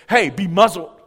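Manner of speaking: sudden loud order, imitating Jesus; commanding rebuke. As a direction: rebuke the storm with authority. Jesus acted commanding the storm.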